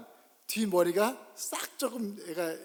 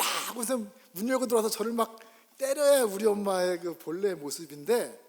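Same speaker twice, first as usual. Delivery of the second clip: ordinary talk, nothing marked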